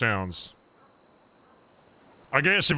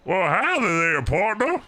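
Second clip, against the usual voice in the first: twangy howdy from a cowboy, pitched up; 8 semitones higher, 4 dB louder than usual. Pretend. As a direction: streamer trying on a cowboy voice, lightly hammed. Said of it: "Now, do I sound".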